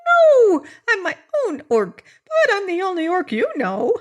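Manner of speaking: very high squeaky voice, loud on the no; tiny orc protesting; tiny creature. Acted. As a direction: speak like a bee-sized orc. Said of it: "replied the tiny creature".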